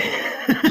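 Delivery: quick breathy, unvoiced chuckle; short laugh. Real, not acted. Co-host joking about the queen of UK, chuckling. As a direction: chuckle at your own joke.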